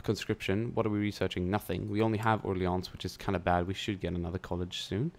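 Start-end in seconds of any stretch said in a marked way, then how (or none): none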